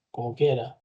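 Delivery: usual speaking voice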